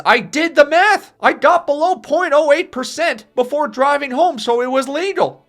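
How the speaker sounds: put-on high, loud drunken retort; defiant yell. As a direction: shout back defiantly. Drunk son defiant to father.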